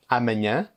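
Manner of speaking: amanhã ending in a nasal ã; amanhã with a clear nasal ending; nasal final vowel. Shown as a demonstration. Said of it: "elle possède toujours le son un".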